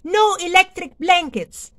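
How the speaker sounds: high, loud flat-out no; firm refusal. Acted. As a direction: shut it down hard. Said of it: "said Farmer Brown".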